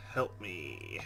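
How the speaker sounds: ordinary talk, nothing marked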